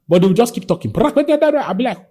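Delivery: high, animated mimicry of a ranting client; excited retelling. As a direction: pitch it way up, breathless and eager.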